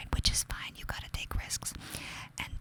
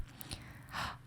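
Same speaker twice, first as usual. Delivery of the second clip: sharp gasp; 14 dB quieter than usual. Real, not acted. Gasp after reading her note.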